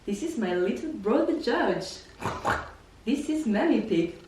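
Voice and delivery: slow, lightly voiced cartoon intro; a hint of a children's cartoon; cartoon narrator voice. Cartoon pig family introduction. (voix de dessin animé)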